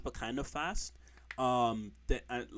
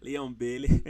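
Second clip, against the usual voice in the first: bad Jamaican accent; 6 dB louder than usual. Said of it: "I can't do a good Jamaican accent".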